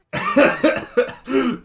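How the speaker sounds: high, loud burst of laughter; amused laugh. Real, not acted. Streamer reacting to June Ripper, amused laugh.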